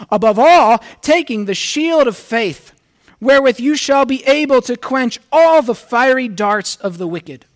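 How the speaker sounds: the preacher reads Ephesians six aloud to the church, forcefully; scripture proclaimed. Acted. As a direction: proclaim the armor of God to the church.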